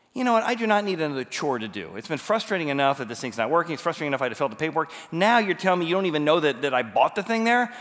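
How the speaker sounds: retold irritated complaint, building in pitch; fed-up venting at the store; annoyed. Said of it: "I'm already kind of annoyed".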